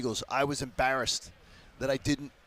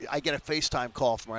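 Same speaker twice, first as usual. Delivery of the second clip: ordinary talk, nothing marked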